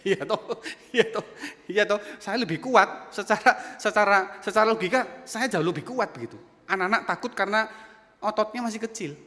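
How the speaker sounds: loud, high, emphatic preaching. Real, not acted. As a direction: hammer the point home, loud. Berkhotbah dengan penuh semangat. (khotbah lantang, menekankan setiap kata)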